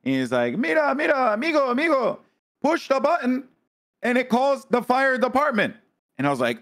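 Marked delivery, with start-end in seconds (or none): 0.6-5.8 high, sustained Spanish-accent impression; Spanish-accented English. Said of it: "doesn't speak English very well".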